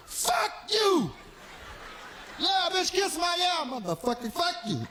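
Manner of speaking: shouting curses, full rage; enraged outburst. Acted. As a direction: explode at the locked-out door. Cursing after forgetting keys.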